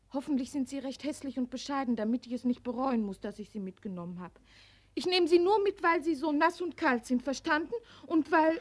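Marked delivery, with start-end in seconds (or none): 5.0-8.6 firm insisting. Acted. Girl insisting, teasing.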